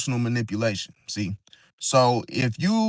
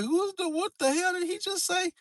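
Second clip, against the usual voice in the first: very high, soft, disbelieving squeak; shocked reaction; 14 semitones higher, 8 dB quieter than usual. Retold or imitated. Passenger reacting to a rude driver, shocked and squeaky. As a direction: sound stunned at what you just heard. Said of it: "they gonna be looking at you like".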